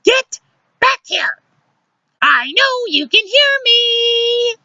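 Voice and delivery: shrieky, very high scolding. A narrator's reading. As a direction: call after him, drawing out the end. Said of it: "Luciana raising her voice".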